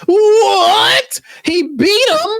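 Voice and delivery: very high, drawn-out "what"; stunned disbelief. Acted. Crew hearing he beat him, stunned. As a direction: squeal it in cartoonish shock.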